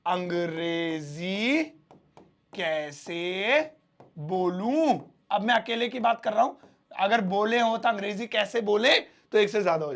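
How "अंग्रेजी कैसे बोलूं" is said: very slow, word by word with pauses; slow dictation. Shown as a demonstration. Teacher dictating the Hindi sentence slowly to students. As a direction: dictate it so the class can follow. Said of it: "ध्यान से देखेंगे".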